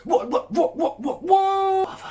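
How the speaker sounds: very high, loud repeated "what" breaking into laughter; squealing disbelief. Pretend. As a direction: shriek it in mock shock. Mock shocked outburst.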